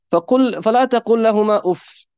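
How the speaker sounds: chanted Arabic verse; Quran recitation. Acted.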